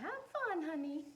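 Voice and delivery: high, fond grandma calling after her; grandma's sweet voice. Acted. Grandma sending her off, high and fond. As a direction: sing it out like a doting grandmother. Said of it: "her grandma says".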